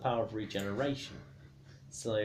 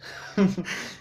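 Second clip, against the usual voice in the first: burst of laughter; 9 semitones higher, 8 dB louder than usual. Real, not acted. Laughs at own rant.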